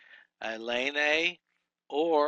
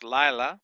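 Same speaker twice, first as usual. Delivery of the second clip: Lila punched louder on its first syllable; stress on first syllable; 7 dB louder than usual. Shown as a demonstration. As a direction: hit the start of Lila firmly. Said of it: "The accent's on the first".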